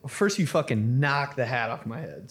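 ordinary talk

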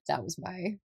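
usual speaking voice

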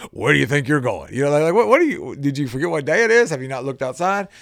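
half-joking shock, voice jumping up; incredulous question. Retold or imitated. Husband in disbelief at wife dressing up on snow day, loud incredulous. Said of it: "It was almost this".

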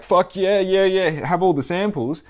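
high and loud, a hyped-up supplier; overeager supplier voice. Retold or imitated. Supplier greeting a big order, high excited hype.

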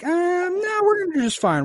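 high, squeaky mock-official voice; mock Tarkin. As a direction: play him as a smug, dismissive official in a falsetto. Mocking Tarkin's dismissive reply.

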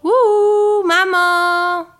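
high, loud, long-held call. A narrator's reading. Marine calls mother from her broom, high cry. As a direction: holler to someone far below. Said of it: "cria-t-elle".

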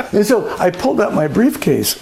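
ordinary talk

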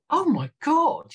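excited. Retold or imitated. Retold producers' delighted reaction.

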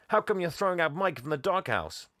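lightly voiced indignant kid; challenging boy. Voices Jack challenging the boss.

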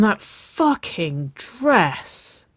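FUCKING drawn out, dress pitched high then falling; "fucking dress" slow and separated, each word leaned on; exasperated emphasis. A narrator's reading. Narrator dwelling on what sets Nicole on fire, exasperated emphasis.

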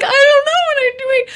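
very high, fast mock wail; dramatised squealing helplessness, loud and rushed; frantic panic. Answering her appalled partner in the aisle, frantic panic.

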